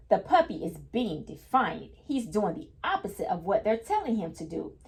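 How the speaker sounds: ordinary delivery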